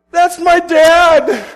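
slow, high, very loud yell; hammed-up shriek of outrage; outraged cry. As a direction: belt it out, stretching each word. Esther's outraged reveal.

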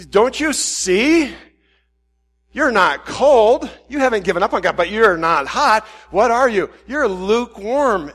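loud, slow, preachy scolding; judgy. Retold or imitated. Pastor mock-scolding a lukewarm counselee, loud and slow. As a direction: lay into him, slowly driving each word home. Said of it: "I'm getting real judgy".

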